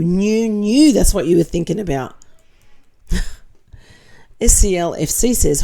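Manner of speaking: ordinary talk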